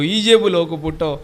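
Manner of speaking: usual speaking voice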